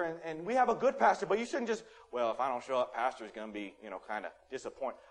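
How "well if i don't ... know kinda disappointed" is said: low, subdued mumbling to oneself; mock reluctant churchgoer. Churchgoer fretting over the pastor's opinion, low and half-hearted. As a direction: drop low and soft, trailing away.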